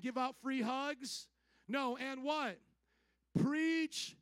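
preacher's questions climbing to a high shout; building preaching. Real, not acted. Preacher rallying a grateful congregation, building preaching. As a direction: drive each question harder, up to a loud peak. Commands congregation at full force.

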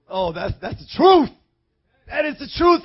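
ordinary talk

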